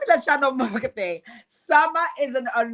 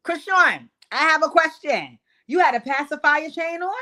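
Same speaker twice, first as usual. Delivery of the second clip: 1 mock questioning; 5 semitones higher, 4 dB louder than usual.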